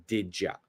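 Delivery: quiet "Didja" with a j sound; one soft fused word "Didja". Shown as a demonstration. Teacher repeating "Didja" softly. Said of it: "My pronunciation is didja".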